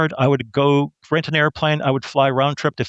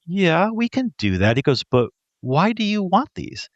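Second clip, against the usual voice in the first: tag; 7 semitones higher than usual. Retold or imitated. Sergeant questions the odd request.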